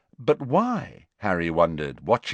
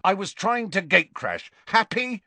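angry; 11 semitones higher than usual. Acted. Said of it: "he said angrily".

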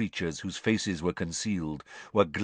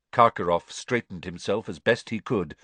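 plain reading voice

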